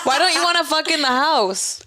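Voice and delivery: high laughing tease. Real, not acted. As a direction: tease her while laughing. Laughing teasing question.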